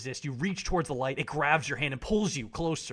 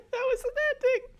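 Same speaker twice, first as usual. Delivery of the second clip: very high squeal of disbelief; squeaky joke voice; 5 dB louder, faster than usual. Real, not acted. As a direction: squeak it out in mock shock. Joking reaction, squeaky.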